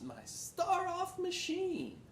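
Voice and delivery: high and slow, drawn out with pride; grand slow showman. A narrator's reading. McBean presenting his machine, high and slow. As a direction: unveil it like a carnival barker.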